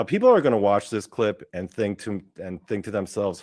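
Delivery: ordinary talk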